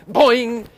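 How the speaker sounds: slow, loud springing boing; cartoon boing sound. Attorney springing from his seat, cartoon boing. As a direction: mimic a seat launching up.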